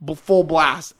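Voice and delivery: ordinary delivery, nothing marked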